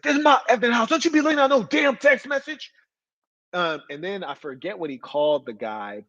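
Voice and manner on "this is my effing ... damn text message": high, loud mock yell of a bullying cop; hammed-up tough-cop bark; imitating a yelling cop. Cop barking at an inmate over a text, mock yell. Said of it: "but they're like".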